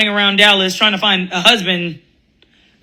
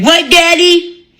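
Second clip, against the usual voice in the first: put-on kids asking; 3 semitones higher than usual. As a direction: play a small child asking innocently. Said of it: "the kids are like".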